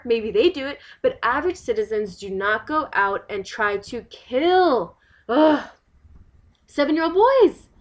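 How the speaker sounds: loud, emphatic protest; outraged insistence. Acted. Resident insists nobody drives vans into boys on purpose, outraged. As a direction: hammer the point home, exasperated. Acted outrage over accusation.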